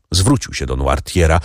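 ordinary delivery

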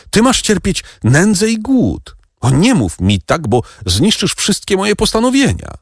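put-on a mother grieving for her child; anguished exclamation. A narrator's reading. Mother crying out to her son in anguish. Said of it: "zawołała Mercedes".

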